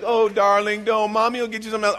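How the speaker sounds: high, mock-indulgent mother voice; coddling mom. Mom soothing her screaming kid, mock coddling. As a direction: imitate a mom placating her child in a high voice. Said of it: "Look at that mom who's like".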